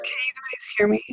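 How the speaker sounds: whispering. Real, not acted. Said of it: "yeah, I can hear you".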